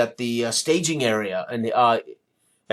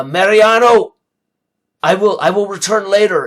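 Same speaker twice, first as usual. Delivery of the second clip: high, loud call across the room; hurried loud call to the barkeep as he leaves; yelling back; 7 semitones higher, 7 dB louder than usual. Acted. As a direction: shout it back over your shoulder. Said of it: "and he yells back".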